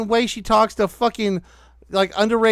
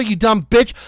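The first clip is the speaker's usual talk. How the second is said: ordinary delivery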